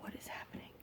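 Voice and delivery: retold under-the-breath disbelief; bewildered whisper. Mother at a loss over screaming daughter, bewildered whisper.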